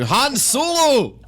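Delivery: thin, high, drawn-out Scottish shout; hammed-up feeble Scottish thug calling out a name; weedy Scottish accent. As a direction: do the weakest Scottish gangster you can. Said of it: "the weediest Scottish person you'll ever hear going".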